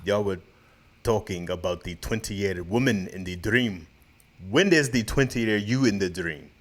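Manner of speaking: put-on laboured comic Russian accent; mock Russian accent. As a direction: read it out in a heavy stage Russian accent. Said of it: "read the comments in Russian".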